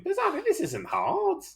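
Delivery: high-pitched exasperated plea; exasperated chiding. Real, not acted. Teacher to a student holding up the class, exasperated. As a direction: chide the student, pitch leaping right up.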